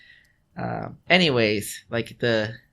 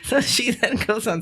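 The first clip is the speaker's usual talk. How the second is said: higher, real laughing speech; giggling storyteller, pitched up; talking through laughter; 13 semitones higher, 4 dB louder than usual. Real, not acted. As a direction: push on while cracking up. Laughing after own retold punchline.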